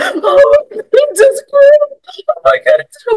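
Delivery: high, loud, weepy sobbing over a squirrel; crying over a squirrel. Retold or imitated. Telling a friend how she sobbed at a squirrel, high weepy cry. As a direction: cry out, overwhelmed, at a cute squirrel. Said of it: "Why am I crying?".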